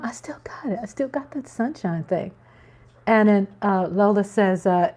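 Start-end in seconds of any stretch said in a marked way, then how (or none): 0.0-2.3 whisper. Real, not acted. Private thrill, said to herself.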